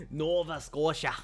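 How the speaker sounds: lightly put-on Scottish accent; playful Scottish-style accent; mock Scottish accent. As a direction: try "Nova Scotia" with a Scottish lilt. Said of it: "as good as a Scottish accent".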